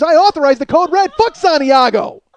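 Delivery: a hammed-up A Few Good Men colonel; movie colonel rant. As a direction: hammer it like a defiant colonel on the stand. Mock Colonel Jessup rant.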